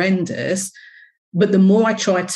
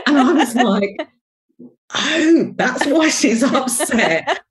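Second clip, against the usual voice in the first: laughing; 8 semitones higher, 3 dB louder, slower than usual. Real, not acted. Laughing at the memory.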